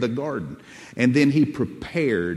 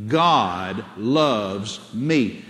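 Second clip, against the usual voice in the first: very slow, each word held and separated; measured declaration for others to repeat; say it out loud; 3 semitones lower, much slower than usual. Shown as a demonstration. Preacher has congregation say it again, slow declaration. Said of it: "Say it again".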